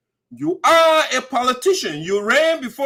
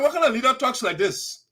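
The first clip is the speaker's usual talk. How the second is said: ordinary delivery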